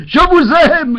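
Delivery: loud shouted declaration; yelled. Retold or imitated. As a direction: shout it high and loud into the mic. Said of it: "j'ai gueulé".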